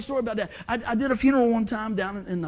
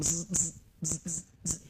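soft buzzing zzz imitating a vibrating phone; 5 semitones lower, 7 dB quieter than usual.

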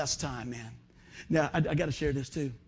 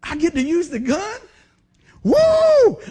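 higher, louder, building to a shrieking woo; gleeful yell; 10 semitones higher, 11 dB louder, slower than usual. Retold or imitated. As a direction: cheer like a kid handed a new toy. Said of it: "he just went like".

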